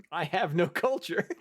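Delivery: laughing through words; giggling while speaking. Real, not acted. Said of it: "laughing at own roll".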